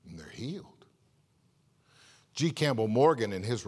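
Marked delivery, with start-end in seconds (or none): 0.1-0.9 whisper. Real, not acted. Awed aside on a miracle.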